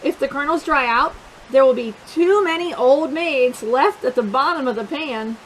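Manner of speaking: usual speaking voice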